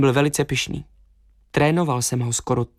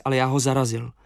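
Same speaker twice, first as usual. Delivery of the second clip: usual speaking voice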